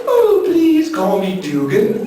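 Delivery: slow, high-pitched imitation of a man. Big man introducing himself at his door, high-voiced. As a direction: draw it out in a put-on high voice. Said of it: "The guy with the high voice".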